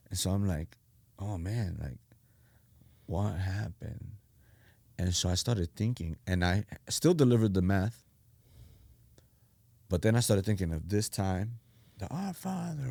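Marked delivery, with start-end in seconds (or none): 1.2-4.1 soft, slow, stunned murmur; quietly bewildered, trailing reaction; retold daze. Retelling his dazed reaction after crying. 12.1-12.8 high, soft recitation; quiet high mock-praying; recalled prayer. Recalling the earlier prayer in passing.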